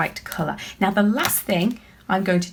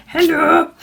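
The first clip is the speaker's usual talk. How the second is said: a high, loud little alien calling hello; squeaky alien hello; 9 semitones higher, 7 dB louder than usual. Pretend. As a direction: greet the kids as a tiny cheerful alien. Voicing drawn alien for kids.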